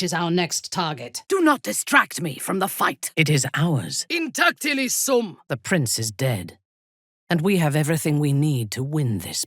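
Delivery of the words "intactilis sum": high incantation; spell cry. Acted. Casting in battle, high incantation. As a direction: intone the protective spell.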